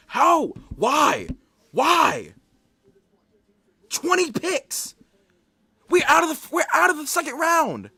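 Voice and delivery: high-pitched furious shouting; outraged, pleading disbelief; so mad. Real, not acted. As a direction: yell it in real exasperation. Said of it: "I'm so mad right now".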